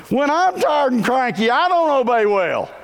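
playing himself as a tired, cranky kid; cranky tired grown-up. Preacher mocks himself as a cranky grown-up.

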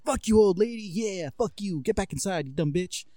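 high, hushed-volume jeer; mock heckling. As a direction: imitate rude boys telling off an old lady. Boys acted abusing an old lady.

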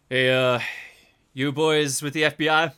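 gruff loud New York cop calling out; loud detective greeting. Acted. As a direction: call out to the agents like a tough cop. GM voices detective greeting agents.

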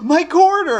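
high, loud astonished squeal; gasp, then cry out in hammed-up wonder; amazed gasp. Tom amazed by coin trick.

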